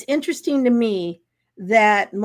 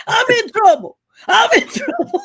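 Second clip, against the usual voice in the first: put-on crying boy; 11 semitones higher, 6 dB louder than usual. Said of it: "he started crying".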